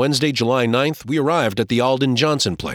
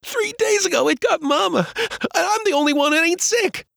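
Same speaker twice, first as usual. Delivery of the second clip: put-on crying boy. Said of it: "He started crying and could not stop".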